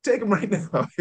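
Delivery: laughing mock mom. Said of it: "I already packed his bags".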